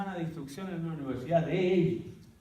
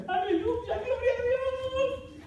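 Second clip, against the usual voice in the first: much higher, stretched jubilant praise; joyful shout; much slower than usual. Retold or imitated. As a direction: jump for joy as you praise. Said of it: "empezaron a saltar hasta arriba".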